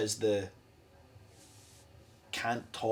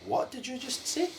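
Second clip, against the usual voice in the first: incredulous; 12 semitones higher than usual. Retold or imitated. Shocked reply to the kid.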